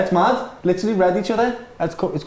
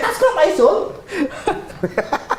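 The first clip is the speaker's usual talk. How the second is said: mock mum; 13 semitones higher, much slower than usual. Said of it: "I was like".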